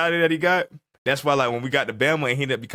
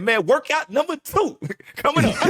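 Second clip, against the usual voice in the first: laughing boast; 12 semitones higher than usual. Retold or imitated. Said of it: "He said".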